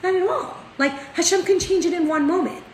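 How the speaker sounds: light imitation of a certain teacher, pitched well up; rebbetzin insisting. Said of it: "she was like".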